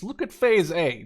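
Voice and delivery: ordinary talk, nothing marked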